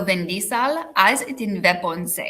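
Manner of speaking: foreign accent, drawn out slowly; slow put-on foreign accent; Schwarzenegger-style accent. Shown as a demonstration. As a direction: say it like a mock Arnold, accent and all. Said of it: "kind of like an Arnold Schwarzenegger".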